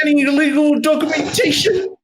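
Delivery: high put-on official voice; prying guard. Guard asking travelers for papers, high prying voice.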